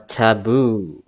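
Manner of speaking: normal talking voice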